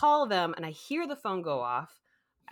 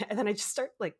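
normal talking voice

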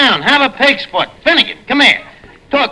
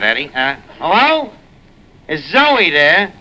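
normal talking voice

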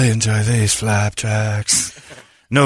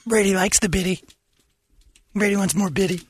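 put-on baby talk voice; 11 semitones higher than usual. Voicing Brady as critter.

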